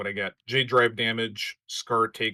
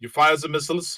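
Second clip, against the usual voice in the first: fake German accent; 6 semitones higher, 7 dB louder than usual. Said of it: "do a fake German accent".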